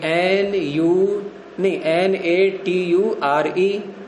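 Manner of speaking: letters spelled one by one, slowly; slow spelling out. Retold or imitated. Teacher dictating a word to children, spelled slowly. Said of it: "वो शब्द लिखवाया".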